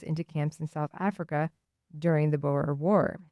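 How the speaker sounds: normal talking voice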